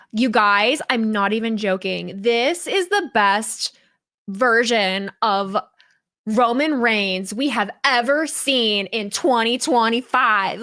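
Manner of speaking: slow, stretched influencer hype; drawn-out mock social-media delivery. Shown as a demonstration. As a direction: imitate an influencer overselling it. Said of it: "I can do the influencer voice".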